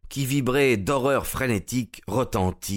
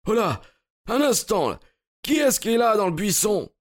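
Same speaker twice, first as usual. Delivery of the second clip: high-pitched hail; calling out; 13 semitones higher than usual. A narrator's reading. Searcher spotting someone in the bush, calling out. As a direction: call sharply into the bushes.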